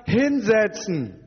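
high, drawn-out command; strenger Befehl. Acted. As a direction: order him down like an exasperated teacher. Orders Petrus to sit down. (gedehnter, empörter Befehl)

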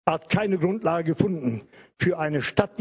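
ordinary delivery

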